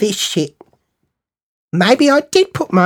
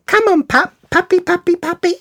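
high, playful pet-calling voice; cooing as if to a little dog; calling a puppy; 13 semitones higher than usual. Retold or imitated. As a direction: coax it over in a high sing-song.

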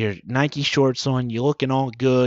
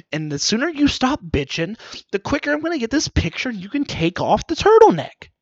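raised, pushy parent scolding; mock parent; 7 semitones higher than usual. Parent snapping at the kid about the Christmas card photo. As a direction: hurry the kid along, exasperated. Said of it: "He's like".

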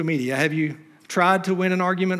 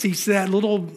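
normal talking voice

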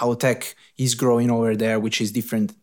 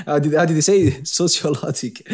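ordinary delivery

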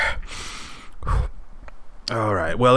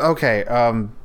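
ordinary talk, nothing marked

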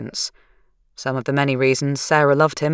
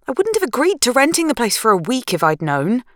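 higher, tense wife's voice; anxious young woman; 9 semitones higher than usual. Acted. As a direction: voice her as high-strung and rattled. Narrator voicing Sarah.

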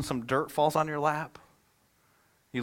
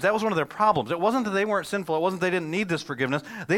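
louder impassioned preaching voice; 7 semitones higher, 5 dB louder than usual. Acted. Pastor urging the congregation they need forgiveness, preaching. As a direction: drive the point home to the congregation.

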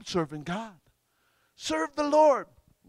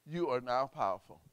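normal talking voice

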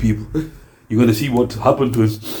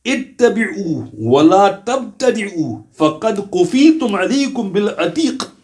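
higher, chanted Arabic recital; Arabic recitation; 6 semitones higher than usual. Shown as a demonstration. Preacher reciting Ibn Mas'ud's Arabic words. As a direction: recite it like a revered saying.

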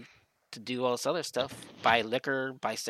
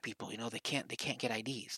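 mock whisper; 8 dB quieter than usual. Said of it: "Why are you whispering?".

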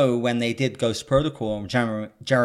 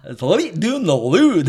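high, slow, hammed-up fake Scottish accent; bad Scottish accent; 7 semitones higher, 7 dB louder, slower than usual. Ignoring her pleas to stop, a slow, loud bad Scottish accent. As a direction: drag out the mangled Scottish line, loud and silly. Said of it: "you can't do a Scottish accent at all".

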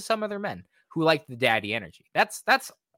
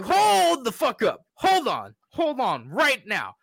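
much higher and louder, slow and drawn out; stunned outrage; 11 semitones higher, 5 dB louder, slower than usual. Retold or imitated. Viewer halting the anime in outrage, slow high shout. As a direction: hold up every word in shocked disbelief. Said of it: "I was like".

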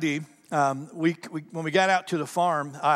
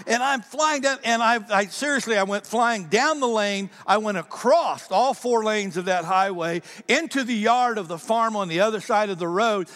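ordinary delivery

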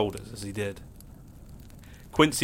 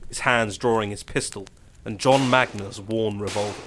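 ordinary delivery

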